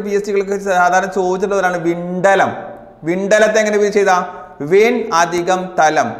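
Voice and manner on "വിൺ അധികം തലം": stretched word by word; slow dictation. Shown as a demonstration. Teacher to exam students, slowly dictating the split. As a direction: dictate it so they can copy it. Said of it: "teacher dictates the split form".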